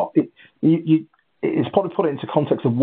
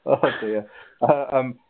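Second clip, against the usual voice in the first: ordinary delivery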